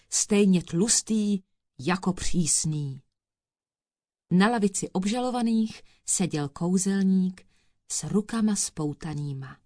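plain reading voice

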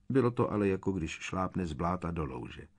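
usual speaking voice

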